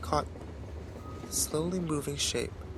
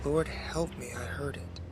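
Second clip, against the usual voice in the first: much softer, awed hush; hushed awe; 6 dB quieter than usual. A narrator's reading. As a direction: breathe it like a prayer. Narrator's awed repetition.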